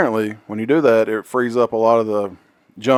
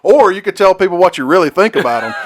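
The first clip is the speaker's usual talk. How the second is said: laughing while quoting; 6 semitones higher, 6 dB louder than usual. Retold or imitated. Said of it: "It's like".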